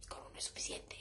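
curt whispered reply; whispering. Retold or imitated. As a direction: hiss it under your breath. Whispered retort.

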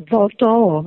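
ordinary talk

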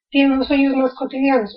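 usual speaking voice

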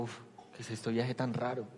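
soft baffled murmur; hushed wonder. Retold or imitated. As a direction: mutter it half to yourself, bewildered. Baffled musing to himself.

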